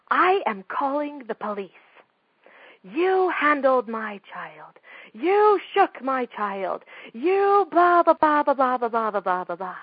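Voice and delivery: loud, furious rant, slowing on the accusations; hammed-up outraged mother yelling, then fading into blah blah; angry mother. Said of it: "because of the anger of this woman".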